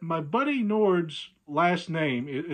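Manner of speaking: normal talking voice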